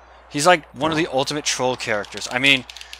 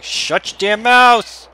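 loud, slow mock yell; 10 semitones higher, 8 dB louder, much slower than usual. Gamer pretending to yell at his screaming niece, slow mock yell. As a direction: pretend to bellow at the kid downstairs. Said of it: "open my door and be like".

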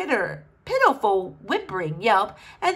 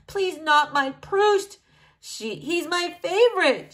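higher, drawn-out plea; distraught; 7 semitones higher, slower than usual. A narrator's reading. Princess loses her favorite books, distraught. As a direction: plead in mild anguish, pitched up. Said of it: "was profoundly distraught".

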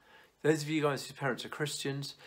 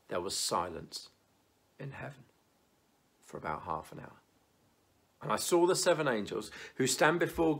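measured Bible reading with long pauses; solemn reading to the congregation; scripture reading; 6 dB louder than usual. Acted. Reads Revelation aloud.

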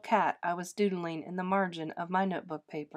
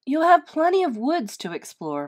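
ordinary delivery, nothing marked

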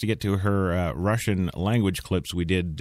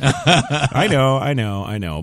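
ordinary talk

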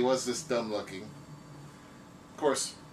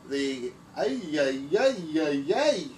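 stammering ay ay, louder; startled stammer; 3 semitones higher, 5 dB louder, slower than usual. Acted. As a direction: caught off guard, sputtering. Drawing suddenly talks back.

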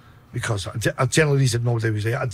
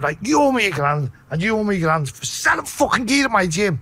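retold angry demand; 8 semitones higher than usual. Gym owner confronting two dealers, retold angry demand. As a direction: re-enact laying down the law, raised voice.

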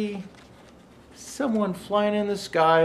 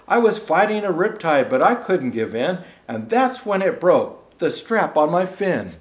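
measured, rhythmic storybook reading; lilting holiday verse reading; poem recitation; 6 dB louder than usual. Acted. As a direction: read it like a bedtime Christmas rhyme. Rhymed Christmas poem read aloud.

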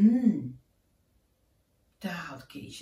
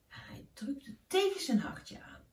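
ordinary delivery

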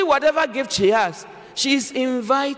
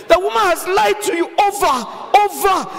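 exasperated shout; 6 semitones higher than usual. Real, not acted. Preacher exasperated at men's blindness.

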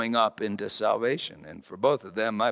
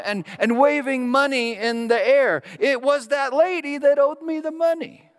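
excited retelling; 12 semitones higher, 9 dB louder than usual. Retold or imitated.

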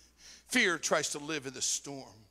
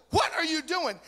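startled cry; 12 semitones higher, 8 dB louder than usual. Retold or imitated. Startled by grinning woman in dark.

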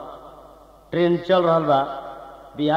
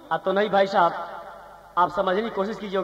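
normal talking voice

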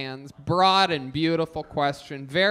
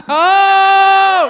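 long, high, loud "oh" of amazement; excited shout; 13 semitones higher, 13 dB louder than usual. Real, not acted. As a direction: whoop it out at full voice. Host reacts to the contest.

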